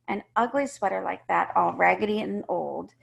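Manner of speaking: ordinary delivery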